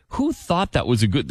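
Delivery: usual speaking voice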